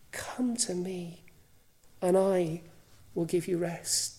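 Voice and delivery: hushed, unvoiced whisper of the invitation. Jesus beckoning the weary, whispered softly. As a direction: breathe it as a gentle whisper.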